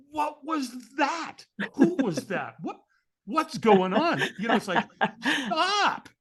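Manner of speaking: high-pitched mock bafflement; comic disbelief, stretched out; bewildered. Firewalker reacting to the man who vanished, bewildered.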